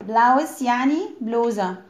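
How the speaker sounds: ordinary talk, nothing marked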